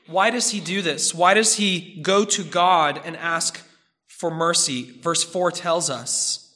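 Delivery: ordinary talk, nothing marked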